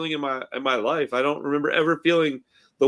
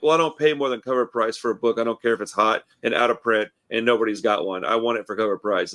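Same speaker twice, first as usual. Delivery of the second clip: lightly voiced stubborn customer, pitched lower; mock entitled customer; 6 semitones lower than usual. Asshole customer at a comic book shop, lightly mocked in lower pitch. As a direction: imitate a demanding customer in a low voice. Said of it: "some asshole going to a comic book shop".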